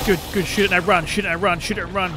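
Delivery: loud, high frantic self-coaching; urgent. Real, not acted. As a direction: shout it while fleeing a monster. Shooting and fleeing the monster.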